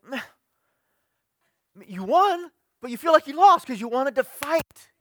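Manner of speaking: high, loud, mock frustrated whine. Frustrated winner complaining.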